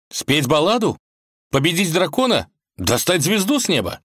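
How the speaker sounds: high-pitched, frantic guesses; desperate suggestions. A narrator's reading. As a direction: throw out wild ideas in despair. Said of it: "Иван в отчаянии предлагал".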